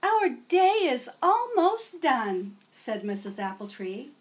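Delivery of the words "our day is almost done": slow, much higher, louder sing-song; teacher closing the school day. Acted. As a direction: wrap up the day like a kindergarten teacher.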